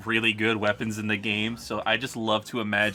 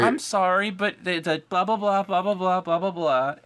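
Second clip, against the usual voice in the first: mocking whiny lecture; 7 semitones higher than usual. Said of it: "They were like".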